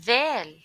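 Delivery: Dal with the tongue between the teeth. Shown as a demonstration. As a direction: say Dal with a th sound. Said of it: "put your tongue between your teeth".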